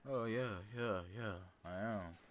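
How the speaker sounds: dazed, slow and soft; drowsy, drawn-out yes, fading; on laughing gas. Retold or imitated. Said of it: "You enjoying that laughing gas over there?".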